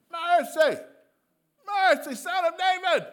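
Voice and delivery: full-out desperate scream, very high. Acted. Blind beggar calling to Jesus for mercy, screaming. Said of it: "blind Bartimaeus starts to scream".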